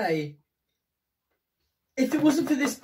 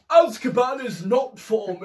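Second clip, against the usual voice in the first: Richard Harris. Acted. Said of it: "He was like".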